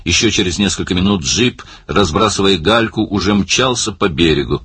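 plain reading voice

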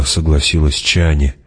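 usual speaking voice

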